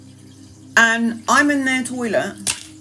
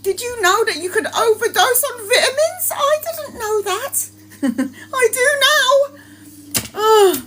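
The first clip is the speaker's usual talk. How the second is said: much higher laughing, near tears; laugh-cry through the confession; 12 semitones higher than usual. Real, not acted. Telling viewers about vitamin overdose, high laugh-cry. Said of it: "laughing crying at herself".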